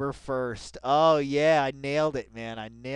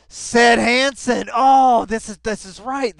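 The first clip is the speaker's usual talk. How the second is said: slow, much higher and louder through laughter; laughing emphasis; 8 semitones higher, 14 dB louder than usual. Real, not acted. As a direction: savour the name while cracking up. Laughing, mock dramatic attribution.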